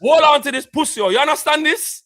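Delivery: loud, high Jamaican patois. Retold or imitated. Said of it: "rasped him up with the patois".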